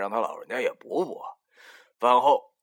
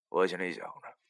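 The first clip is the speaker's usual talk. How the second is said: ordinary delivery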